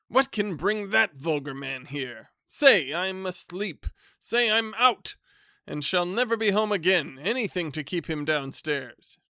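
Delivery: pleading to be hidden from his cousin; fussy bachelor voice. Acted. Bachelor begging the servant to keep his cousin out, panicky.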